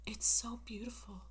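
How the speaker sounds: a soft unvoiced whisper of awe. Woman on the cliff edge, before she falls: whispered. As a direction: breathe it out like a last wonder. Character's last words before falling.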